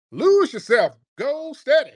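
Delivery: loud, high mock soul brother accent; Barack Obama. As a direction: push a cringey rap in a put-on soul brother accent. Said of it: "trying to talk with a soul brother accent".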